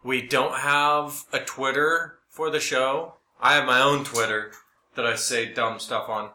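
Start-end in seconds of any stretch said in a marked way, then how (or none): none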